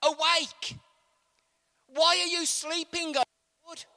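high, loud call to wake up; David crying out in distress; urgent cry. Acted. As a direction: shout up to God urgently. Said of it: "David prays".

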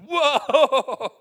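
high, loud gasped whoa; startled whoa. Retold or imitated. The revived brother shouting as he comes to. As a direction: burst back to life with a cry.

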